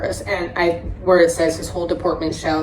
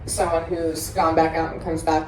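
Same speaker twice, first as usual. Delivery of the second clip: ordinary talk, nothing marked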